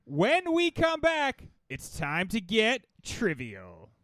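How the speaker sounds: slow, drawn-out segment announcement; show announcer. Acted.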